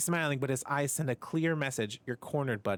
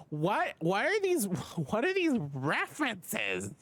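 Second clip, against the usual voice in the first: 1 amused disbelief; 9 semitones higher, slower than usual. Real, not acted. Baffled by game references.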